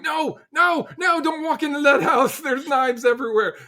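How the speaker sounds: high, squeaky mock alarm like an overprotective parent; mock panicked warning. Leader imitating himself warning his team, high frantic mock panic. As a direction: shriek it like a worried mother hen.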